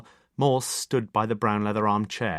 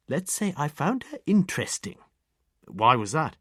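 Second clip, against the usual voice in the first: put-on much higher, louder, primly precise elderly lady; prim old lady; 5 semitones higher, 4 dB louder than usual. A narrator's reading. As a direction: tick off the inspector like a matron. Old lady describes the visitor.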